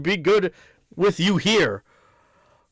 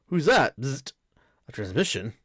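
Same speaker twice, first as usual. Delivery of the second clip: ordinary delivery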